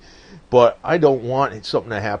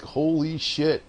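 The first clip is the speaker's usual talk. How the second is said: slow, stunned put-on voice; Donald Trump impression; 4 semitones higher, 4 dB quieter, much slower than usual. Donald reacting to his ex-wife running, slow stunned mock voice. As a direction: drag out the shock. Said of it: "'Cause then Donald would be like".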